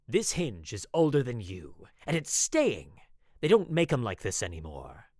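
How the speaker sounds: loud, barked gruff husband; growling husband refusing to budge; harsh bark. A narrator's reading. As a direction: bark it out like a stubborn old man. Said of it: "His answer was a harsh bark".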